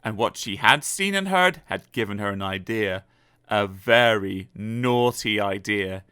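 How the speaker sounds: usual speaking voice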